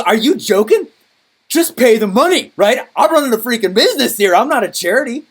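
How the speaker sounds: loud, high-pitched indignant rant; mad. Retold or imitated. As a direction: reenact an outraged outburst. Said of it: "I was so mad".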